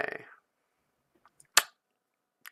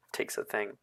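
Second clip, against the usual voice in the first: ordinary talk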